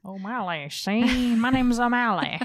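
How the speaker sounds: put-on old-time detective. Cohost joking as detective O'Malley cracking a suspect, hammed-up tough cop. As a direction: ham up a movie detective's interrogation.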